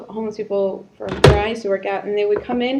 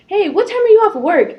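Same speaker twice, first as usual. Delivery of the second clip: mock calling out; 9 semitones higher, 5 dB louder than usual. Woman at bus stop calling.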